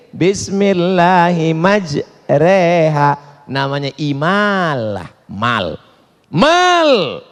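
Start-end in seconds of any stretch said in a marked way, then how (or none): 0.1-3.1 "Bismillahimajreha" chanted slowly with "re" for "ro"; bacaan miring. Shown as a demonstration. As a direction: draw out "Bismillahimajreha", the long a leaning to e. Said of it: "tapi bacanya miring". ("Bismillahimajreha" dilantunkan miring dan pelan)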